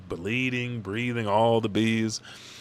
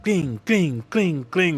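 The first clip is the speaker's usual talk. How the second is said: high ringing "cling" repeated; imitated metal clanging; 8 semitones higher, 5 dB louder, slower than usual. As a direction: mimic axes clashing, each falling in pitch. Imitates clashing axes.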